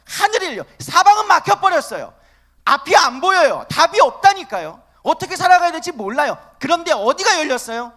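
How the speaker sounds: rising, loud, insistent preaching; preaching at full force. Acted. Pastor urging the congregation toward the open heaven, full-force preaching.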